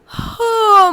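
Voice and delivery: long sleepy yawn, falling in pitch; acted yawn. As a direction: yawn as if badly needing sleep. Yawn before saying she is sleepy.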